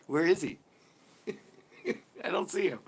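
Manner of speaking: high, teasing mock puzzlement; mock searching. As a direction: pretend to look for a rival left behind. Mocks searching for beaten racer.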